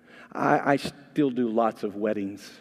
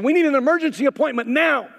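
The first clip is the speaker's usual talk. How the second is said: urgent groom; 12 semitones higher, 7 dB louder than usual. Retold or imitated.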